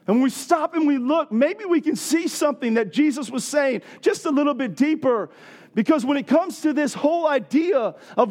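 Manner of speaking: high, loud-edged, impassioned preaching; excited preaching. Real, not acted. Preacher urging congregation to get dirty loving others, excited. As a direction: drive each point home at a high, excited pitch. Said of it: "I know I'm getting excited".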